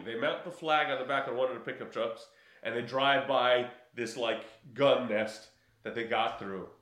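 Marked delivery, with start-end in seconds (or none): none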